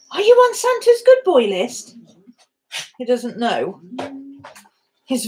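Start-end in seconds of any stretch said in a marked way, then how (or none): 0.1-2.0 much higher, louder sing-song question to the dog; squeaky pet talk. Real, not acted. Teasing the dog, higher take.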